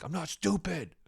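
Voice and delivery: high-pitched indignant protest; re-enacted defensive squeal; mad, defensive. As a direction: protest like a stung child, hammed up. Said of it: "I was actually mad".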